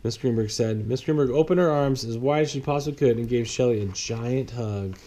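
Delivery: usual speaking voice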